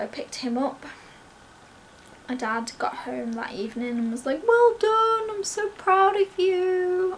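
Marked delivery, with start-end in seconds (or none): 4.5-7.1 high, gushing, drawn-out praise; proud congratulations. Retold or imitated. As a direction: imitate a dad bursting with pride. Dad's congratulations retold.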